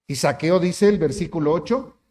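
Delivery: usual speaking voice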